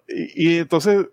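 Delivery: ordinary talk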